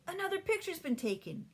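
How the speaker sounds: high, loud cry of discovery; excited shout. A narrator's reading. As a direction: call the others over. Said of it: "he shouted".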